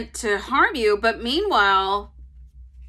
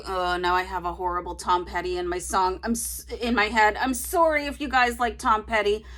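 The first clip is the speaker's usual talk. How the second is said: ordinary delivery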